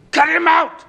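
sudden high, loud shout of the threat; a furious preacher's shout. Acted. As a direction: bellow it out in anger.

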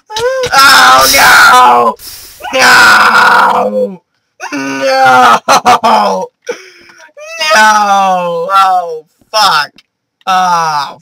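loud despairing no and curses; anguished yelling. Real, not acted. Gamer screaming as his loot burns. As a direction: yell out the loss. Losing items in lava.

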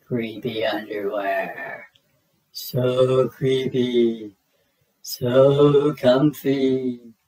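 slow, hushed awe building to a delighted sigh; awestruck bunny. Acted. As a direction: savour each word like treasure. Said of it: "They were glorious".

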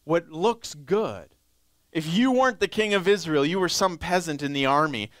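ordinary talk

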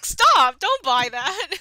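loud laughing shout; excited. Real, not acted. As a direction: yell it through laughter. Begging co-host not to redeem.

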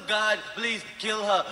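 put-on slow, pleading child prayer; slow kid prayer. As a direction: pray it slowly like a sulky kid. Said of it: "Be in the bedroom going".

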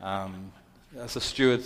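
usual speaking voice

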